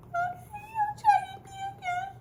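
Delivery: imitating her younger self, high and tearful; whimpering child voice. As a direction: squeak it out like a frightened little girl near tears. Retelling her scared child self.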